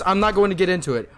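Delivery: normal talking voice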